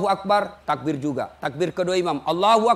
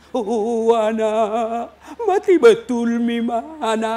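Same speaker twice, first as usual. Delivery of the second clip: slow, sing-song lament, hammed up; mock wailing mourner; much slower than usual. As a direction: wail like a mourner at a funeral. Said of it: "Ada yang menangis sampai tinggal dikasih kacapi".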